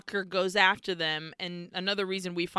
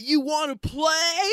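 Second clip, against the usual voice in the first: hotheaded taunt; 7 semitones higher than usual. Acted. Walker challenges the dudes, high hotheaded shout.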